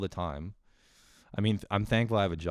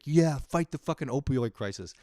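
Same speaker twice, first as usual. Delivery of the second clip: mock rallying cry; 7 semitones higher, 5 dB louder than usual.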